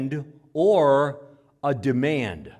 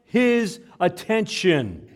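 urgent shout; 5 semitones higher, 5 dB louder, much slower than usual. Shown as a demonstration. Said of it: "almost as a shrieking".

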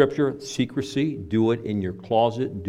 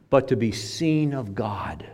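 a secret spoken under the breath; slow reverent whisper; hushed whisper; slower than usual. Acted. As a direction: whisper it slowly, just for God. Stressing secret worship before God.

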